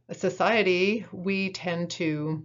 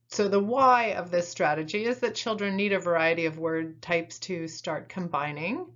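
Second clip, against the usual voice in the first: ordinary talk, nothing marked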